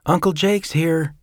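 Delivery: put-on a small boy chatting to his uncle; little boy Jeffrey. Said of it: "Jeffrey called out".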